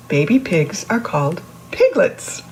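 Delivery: plain reading voice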